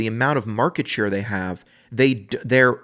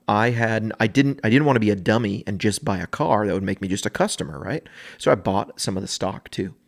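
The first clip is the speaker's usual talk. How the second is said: normal talking voice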